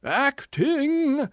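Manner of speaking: high, loud outraged scream. Acted. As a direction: shriek it in offended disbelief. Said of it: "screamed the tragedian".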